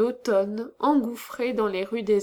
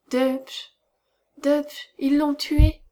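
plaintive murmur; 4 semitones higher, slower than usual. A narrator's reading. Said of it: "de sa voix plaintive, murmura".

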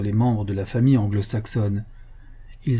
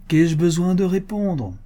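higher-pitched note of triumph; triumphant exclamation; 7 semitones higher than usual. A narrator's reading. Speaker crows over the Bible verse he found, triumphantly. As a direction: exclaim it as if the argument is won. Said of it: "avec un accent de triomphe".

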